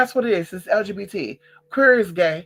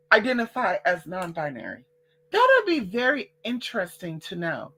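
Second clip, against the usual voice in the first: ordinary talk, nothing marked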